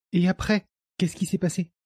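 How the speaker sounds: high, curious listener's voice; young woman's voice. A narrator's reading. Manon prompts the old man's story, high woman's voice.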